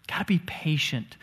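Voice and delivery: whispered under the breath, no voice. Acted. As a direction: lean in and whisper it like a secret. Pastor urges patience in tense talks.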